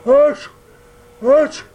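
sharp high loud sneezes; comic harsh sneezes; sneezing. Acted. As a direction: let two sneezes burst out. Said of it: "Il éternua deux fois encore".

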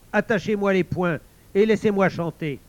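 loud, high, commanding boast; defiant villain giving loud orders; Ikotomé shouting. Acted. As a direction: order them about with swagger. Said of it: "Ikotomé cria".

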